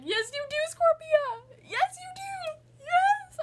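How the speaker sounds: high, loud, near-crying cheer, slow on the repeat; yelling support at the screen with a breaking voice; tearful cheering. Retold or imitated. Fan cheering Scorpia on at the screen, tearful loud cheer. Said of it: "I'm gonna start crying".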